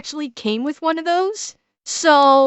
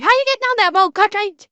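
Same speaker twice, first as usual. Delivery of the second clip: quick, raised mock Newfie accent; 5 semitones higher, faster than usual. Shown as a demonstration. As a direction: imitate a thick Newfoundland accent. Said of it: "If it was a Newfie accent".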